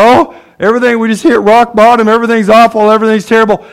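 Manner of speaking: loud, high mock wailing complaint; mock despair. Preacher mimicking someone at rock bottom, mock despair. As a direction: moan loudly as if all is lost, hammed-up.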